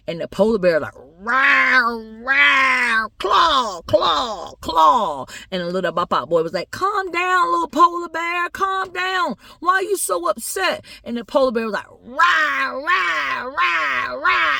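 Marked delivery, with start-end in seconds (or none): 0.9-5.3 high, loud bear roars; roaring polar bear. Acted. Polar bear roaring at the little boy, high and fierce. 6.7-10.8 put-on high-pitched little boy voice; pleading little boy. Little boy telling the polar bear to calm down, high pleading. 11.9-14.5 high, repeated bear roars; roaring polar bear. Acted. Polar bear roaring back at the boy, high and agitated.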